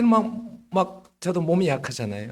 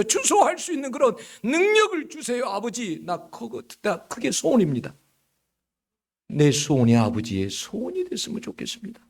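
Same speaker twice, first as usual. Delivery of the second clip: impassioned pleading prayer; 4 semitones higher than usual. Retold or imitated. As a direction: swell into a fervent re-enacted plea. Prayer re-enacted, builds into pleading. (간절하게 목소리 높여 부르짖는 기도)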